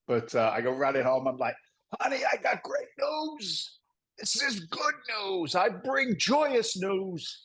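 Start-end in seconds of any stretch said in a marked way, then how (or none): 2.0-7.4 high, slow, drawn-out proclamation; mock-grand good news. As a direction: herald joy to your wife, hammed up. Acted announcement to wife.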